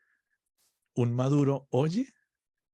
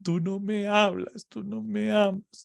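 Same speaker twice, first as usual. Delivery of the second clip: whiny complaint; 5 semitones higher than usual. Retold or imitated. Whining believer complains to God.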